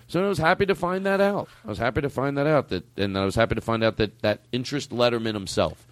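ordinary talk

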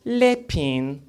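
put-on French accent; mock French. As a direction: say it as a fake Frenchman. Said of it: "penis in French".

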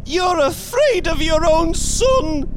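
put-on loud, very high old woman; cried, struggling. A narrator's reading. Mother struggling with her frightened husband, loud cry. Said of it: "she cried, struggling".

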